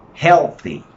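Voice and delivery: "Healthy" with loud HEAL, light "thy"; stress on first syllable. Shown as a demonstration. Teacher showing the class the stress, loud HEAL in "Healthy".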